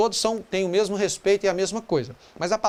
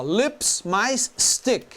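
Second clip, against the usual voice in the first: lips mais stick, each part slowly set apart; slow breakdown; much slower than usual. Shown as a demonstration. Teacher slowly splitting the compound word. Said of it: "Palavra lipstick".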